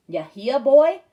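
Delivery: put-on hillbilly accent, a tag question barked on boy; Southern hillbilly accent, rising on hear. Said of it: "Dad did an imitation of a hillbilly accent".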